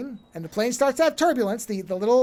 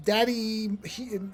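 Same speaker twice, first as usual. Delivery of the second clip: ordinary delivery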